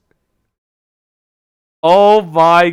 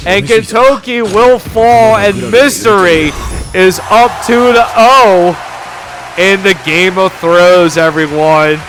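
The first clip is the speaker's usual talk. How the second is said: loud, rising hype call; excited play-by-play; 3 semitones higher, 6 dB louder than usual. Real, not acted. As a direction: shout the winning moment to the crowd. Caster calls the winning play.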